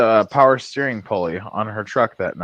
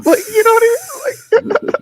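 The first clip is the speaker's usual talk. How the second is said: squeaky laughing words; 7 dB louder than usual. Real, not acted. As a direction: talk through high laughter. Laughing at his own story.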